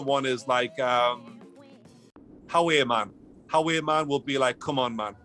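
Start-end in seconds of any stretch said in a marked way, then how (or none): none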